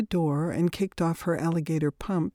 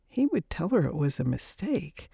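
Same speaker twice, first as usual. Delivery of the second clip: usual speaking voice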